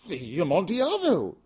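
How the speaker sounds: high, loud startled cry; Fortunato's astonished outburst; astonished exclamation. Acted. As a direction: blurt it out in shock. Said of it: "ejaculated my friend".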